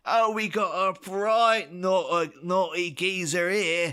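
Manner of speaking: terrible mock English accent, high and drawn-out; Candy. Game henchwoman greeting Batman, imitated awful English accent. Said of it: "That is the worst English accent".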